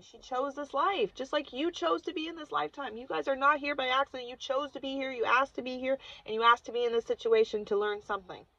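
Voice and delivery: insistent shaman setting someone straight; firm reminder. Retold or imitated. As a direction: press each point home as a reminder. Shaman firmly reminding her.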